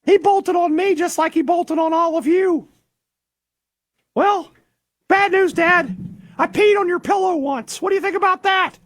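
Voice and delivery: very high-pitched happy dog voice; Juice. Acted. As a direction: squeak it out like a cheerful pup. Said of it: "can I be the voice of Juice for this?".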